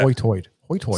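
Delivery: low, trying out "Hoy-toid" with OY vowels; OY for long I in "Hoy-toid"; island pronunciation. Retold or imitated. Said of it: "that's how they say high tide".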